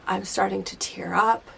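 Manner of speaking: ordinary talk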